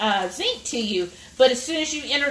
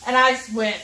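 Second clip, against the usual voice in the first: ordinary talk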